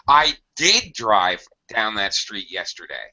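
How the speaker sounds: "did" stressed hard, slow and deliberate. Shown as a demonstration. Teacher showing emphasis: "did" stressed hard. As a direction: lean on "did" to insist. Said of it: "accompanying intonation".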